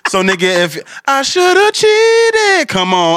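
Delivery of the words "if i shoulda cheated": much higher, slow stretched whine; mock sulk. Mocking a partner sulking over a song, slow high whine.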